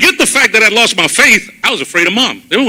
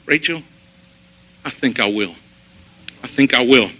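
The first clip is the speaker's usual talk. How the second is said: soft sincere promise; 7 semitones lower, 6 dB quieter than usual. Retold or imitated. Quiet promise to Rachel.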